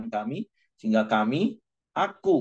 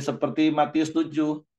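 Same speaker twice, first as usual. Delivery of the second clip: ordinary talk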